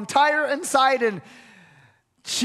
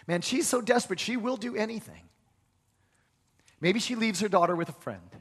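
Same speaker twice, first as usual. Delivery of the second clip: ordinary delivery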